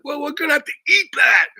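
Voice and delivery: high, loud furious shout; hammed-up screaming doctor; doctor yelling. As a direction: yell it at the patient in defeat. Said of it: "He's screaming at me".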